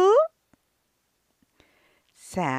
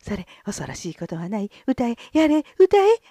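flustered panic; 6 semitones higher, 5 dB louder than usual. Acted. Gonzo panics before the lord.

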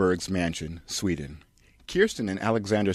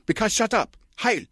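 high, pompous mock-German director voice; smug art-house auteur declaring; Lars von Trier; 13 semitones higher, 3 dB louder than usual. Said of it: "Director Lars von Trier".

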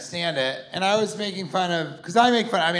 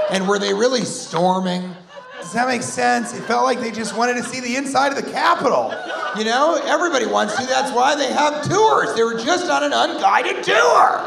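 much higher, louder sarcastic rant; mock indignant rant; 8 semitones higher, 5 dB louder than usual. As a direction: defend it with hammed-up outrage. Mock defense of rioters.

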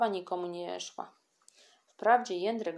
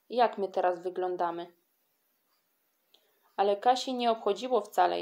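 ordinary delivery

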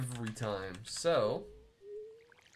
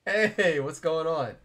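high, loud hello to the chat; cheery raised greeting; 4 semitones higher, 7 dB louder, slower than usual. Real, not acted. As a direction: call out to the newcomers. Greeting incoming raid.

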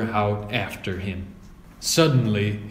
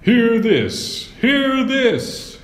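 much higher and louder proclamation; shouting knight; 11 semitones higher, 4 dB louder, much slower than usual. A narrator's reading. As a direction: call out to the whole crowd. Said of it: "he shouted".

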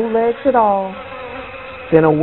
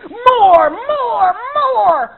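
ghost crying out; 13 semitones higher, much slower than usual. A narrator's reading. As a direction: demand more, each one a shout. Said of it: "cried the ghost".

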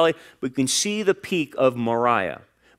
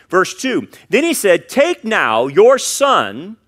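slow, drawn-out reading of Take now your son; solemn slow reading; slow scripture read; 4 semitones higher, 5 dB louder, slower than usual. Acted. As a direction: weigh each word slowly. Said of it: "Notice the threefold identification here".

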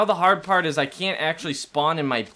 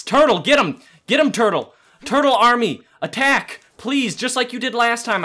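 loud, raised-pitch battle commands; urgent rallying call; 7 semitones higher, 5 dB louder than usual. Real, not acted. Player to turtle army, loud excited urging. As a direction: cheer on your army to attack.

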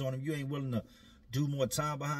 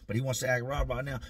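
normal talking voice

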